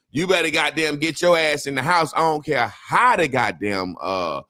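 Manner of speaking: ordinary talk, nothing marked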